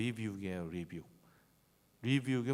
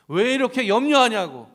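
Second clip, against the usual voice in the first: much higher, louder cry; a loud, high preaching cry; shouted climax; 11 semitones higher, 18 dB louder than usual. Real, not acted. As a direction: burst out at the peak.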